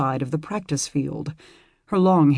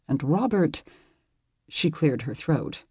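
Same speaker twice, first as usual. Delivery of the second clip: ordinary delivery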